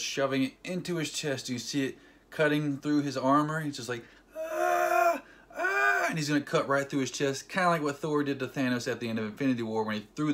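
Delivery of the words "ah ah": high strained "ah"; hammed-up agonized wail; imitated pained cry. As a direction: mimic someone being cut, voice pushed way up. Imitates hero being cut.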